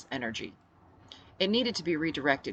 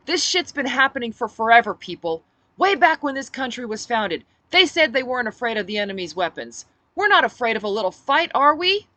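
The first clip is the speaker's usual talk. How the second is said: rousing speech; 7 semitones higher, 10 dB louder than usual. Acted. Speech to crowd.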